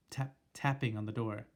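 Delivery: usual speaking voice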